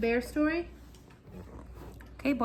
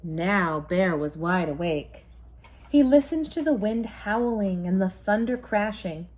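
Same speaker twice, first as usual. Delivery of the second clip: story narration; 6 dB louder than usual. A narrator's reading. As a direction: tell it like a bedtime story to a child. Bedtime story narration continues.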